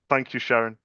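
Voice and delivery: ordinary talk, nothing marked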